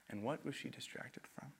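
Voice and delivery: soft whispered question; hushed, secretive question. Real, not acted. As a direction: lean in and whisper it. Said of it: "whispered question".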